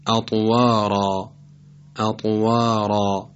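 slow اطوارا with thick r and dark alef; heavy ra. Shown as a demonstration. Tajweed teacher illustrating the rule, heavy ra in اطوارا. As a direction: stretch اطوارا, making its final ra full and emphatic.

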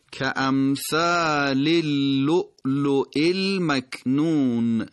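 امثال اللؤلؤ المکنون slowly chanted in one link; three-word linking. Shown as a demonstration. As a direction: join "ka-amthāli-l-luʾluʾi-l-maknūn" for امثال اللؤلؤ المکنون.

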